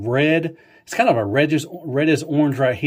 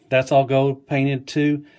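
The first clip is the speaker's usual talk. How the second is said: normal talking voice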